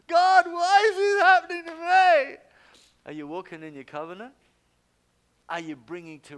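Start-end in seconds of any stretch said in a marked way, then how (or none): 0.1-2.4 very high, very loud mock whine; whiny wail. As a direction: ham up a self-pitying complainer. Mocking a complaining churchgoer.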